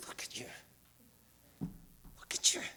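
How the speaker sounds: hushed, almost unvoiced, slowing down; tender whisper. Real, not acted. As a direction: whisper it like you're in awe of them. Tender moment with audience.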